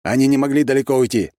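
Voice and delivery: high, loud urgent call; shouted order. A narrator's reading. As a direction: shout it to the guards. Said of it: "крикнул я".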